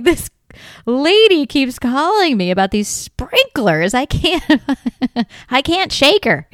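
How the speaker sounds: drawn-out, hammed-up grumble about a pest; exasperated complaint. Imagines handyman complaining at home.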